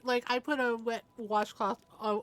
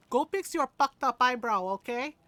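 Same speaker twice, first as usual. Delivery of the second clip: put-on louder Filipino-accented order; Filipino mother; 2 semitones higher, 6 dB louder than usual. Filipino mom sending son to fix eyebrow, accented command. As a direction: imitate a bossy Filipino mom. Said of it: "our little tiny Filipino mother".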